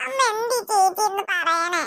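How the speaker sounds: slow, crying question to mom; tearful child. Acted. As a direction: whimper the question. Girl still tearful.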